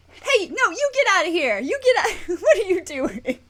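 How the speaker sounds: high-pitched shooing that breaks into laughter; laughing protest. Real, not acted. Streamer interrupted during a moment, laughing protest. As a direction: shoo them out, half laughing.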